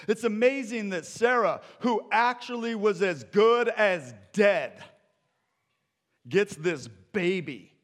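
loud, high preacher's exclamation; forceful preaching. Real, not acted. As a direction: proclaim it to the congregation in amazement.

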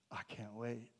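soft unvoiced sigh, then a hushed "I can't wait"; longing sigh. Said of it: "but I'm like".

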